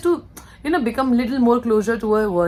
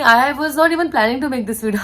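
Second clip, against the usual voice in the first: usual speaking voice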